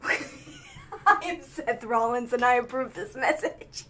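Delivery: low, slow announcer voice, laughing; deep campaign-ad sign-off; mock political ad. Impersonating Seth Rollins ad line.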